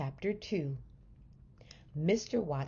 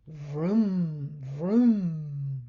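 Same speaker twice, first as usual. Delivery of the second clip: dreamy engine noise; a sleeper driving a fast car; lightly voiced car sounds; car vroom vroom; 5 dB louder than usual. A narrator's reading. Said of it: "Mister Watson said".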